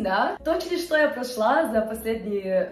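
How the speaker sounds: ordinary delivery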